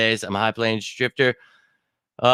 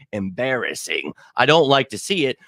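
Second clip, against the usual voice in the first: usual speaking voice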